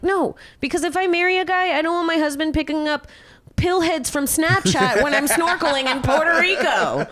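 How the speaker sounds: high, loud incredulous rant; laughing at how absurd he is, loud and high; outraged rant. Retold or imitated. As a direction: tear into him, voice way up. Ranting at boyfriend in retold fight.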